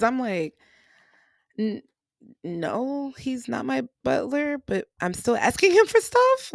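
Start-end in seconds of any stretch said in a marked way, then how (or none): 1.6-6.5 ends in a high, loud protest; indignant protest. Retold or imitated. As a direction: push back, building up to a high loud last line. Retold reply to the preacher.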